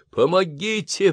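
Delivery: high, slow call for help. Acted. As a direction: call out into the fire. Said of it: "позвал он".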